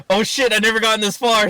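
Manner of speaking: high, loud flustered aside; mock panic. Prank caller suddenly out of script, mock panic. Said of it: "So I'm just like".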